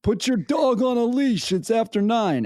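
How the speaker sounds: high-pitched New York policeman voice; New York accent. Retold or imitated. Policeman telling a dog walker off, in a high New York accent. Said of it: "like, a New York accent".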